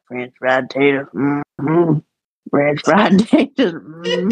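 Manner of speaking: low movie-quote voice with a hummed mm-hmm; Uncle Jerry. Retold or imitated. Niece retells kitchen game, imitating uncle's low movie-quote voice. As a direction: imitate an uncle quoting a film, dropping low. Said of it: "this is how he would say it".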